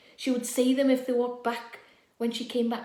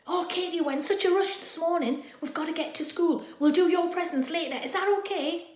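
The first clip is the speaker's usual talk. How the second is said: higher, louder hurried mum; breezy rushed mother; busy mum; 6 semitones higher, 4 dB louder than usual. Acted. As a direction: mum brushing off the birthday. Mum voiced hurrying.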